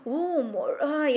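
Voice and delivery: slow, stammering terrified cry; scared babble. A narrator's reading. Boy frightened by a snake on the dark road, stammering in fear. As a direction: blurt it out in fright. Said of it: "என்று பயந்து குளறினான்".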